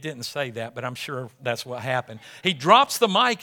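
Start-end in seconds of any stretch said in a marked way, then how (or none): 2.4-3.4 emphatic louder repeat. Shown as a demonstration. Pastor hammering the point to the congregation, emphatic. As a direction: punch it louder, rising at the end.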